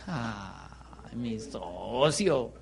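low, drawn-out mock pity; vecino escéptico. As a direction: stretch it out softly and low, like a doubting neighbour. Voices a skeptical neighbour's reply. (con lástima fingida, bajito y alargado)

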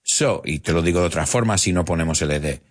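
ordinary talk

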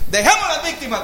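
high, proclaiming "Dejemos"; God speaking. Retold or imitated. God on his throne declaring, high and grand. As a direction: announce it from the throne.

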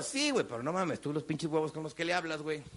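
low, soft sober reply to himself; inner voice. Retold or imitated. His inner voice answering him, low and calm. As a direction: talk yourself straight, quietly.

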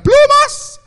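very high, loud, frantic screams of plumas; panicked woman yelling plumas at robbers; shrill screaming. Retold or imitated. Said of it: "comenzó a gritar".

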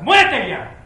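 sudden high, loud acted demand; mock angry outburst. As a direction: burst out as the ungrateful son. Acts son's demand to father.

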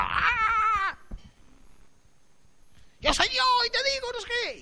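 chillido muy agudo y rápido; grito burlón. Acted. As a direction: imitando a la carne que se cree Dios. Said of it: "Y el otro grita". (la carne grita que es Dios, chillido exagerado)

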